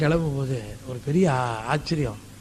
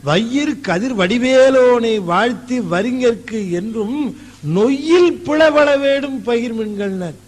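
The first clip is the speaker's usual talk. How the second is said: slow, raised recitation of a sacred verse; reciting verse; 5 semitones higher, 4 dB louder than usual. Acted. Preacher quoting a poet's verse to devotees, slow and intoned. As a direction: intone the lines slowly and higher. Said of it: "அந்த அன்னையநாதன் சொல்லுகிறார்".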